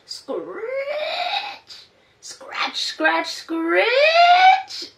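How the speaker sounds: hoe scratching. Acted. Noise of a hoe.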